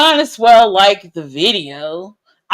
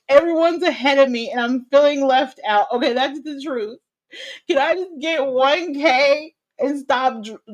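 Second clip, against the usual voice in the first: ordinary talk, nothing marked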